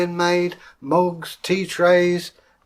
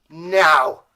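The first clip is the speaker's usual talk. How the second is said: loud no; 3 semitones higher, 9 dB louder than usual. Acted. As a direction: snap it back. Dwarf angry no.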